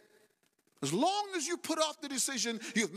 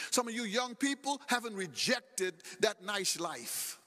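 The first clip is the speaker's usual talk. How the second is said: ordinary delivery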